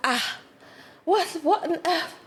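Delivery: high, laughing what on earth; mild incredulous laugh; shocked disbelief. Retold or imitated. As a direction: gasp it out in amused shock. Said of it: "And I'm just like".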